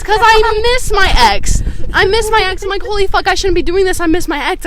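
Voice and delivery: high, loud mock wail; exaggerated, worked-up lament about an ex; retold crying. Storyteller retelling crying over an ex mid-kiss, mock-distraught. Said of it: "I start crying while we start making out".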